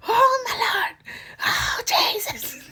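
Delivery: very high, loud comic wail; crying scream. Retold or imitated. Patient as the cage goes over her head, hammed-up screaming. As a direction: re-enact a screaming cry to heaven. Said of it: "I'm crying and I'm screaming".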